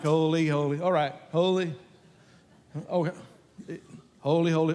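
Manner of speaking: a mock-weary, dutiful holy; bored repetition. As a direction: drag it out like someone tired of repeating. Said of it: "we would have been like".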